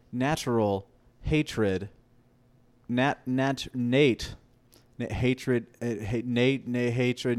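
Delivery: Natred, sounding out "Natural hatred" slowly then blurting it; slowly mash "Natural hatred" into "Natred"; working out a name. Shown as a demonstration. Finding his new name at the campfire, sounding it out. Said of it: "That's my fucking dag name now".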